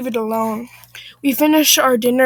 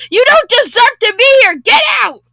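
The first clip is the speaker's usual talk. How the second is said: high-pitched, loud, hammed-up yell; irate, screeching outburst; furious shout; 11 semitones higher, 9 dB louder than usual. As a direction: scream them out of the place. Acted furious outburst in quarrel.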